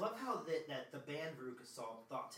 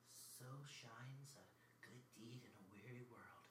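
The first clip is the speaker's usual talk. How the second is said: soft quoted line; 2 semitones lower, 14 dB quieter than usual. Pretend. Quoting Willy Wonka line softly.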